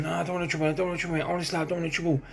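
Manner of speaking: lightly acted scared appeasing; mock scared pleading. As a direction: imitate backing off from a fight. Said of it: "as a scared guy who's backing off".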